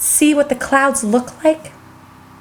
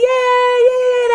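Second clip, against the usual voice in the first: excited cheering; 9 semitones higher, slower than usual. Real, not acted. Cheering at picnic news.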